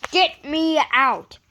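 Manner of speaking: slow, loud desperate call; calling for help. A narrator's reading. Gordon stuck in the ditch calling, slow loud plea. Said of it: "he called".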